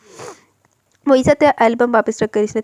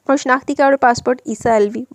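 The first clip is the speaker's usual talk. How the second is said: plain reading voice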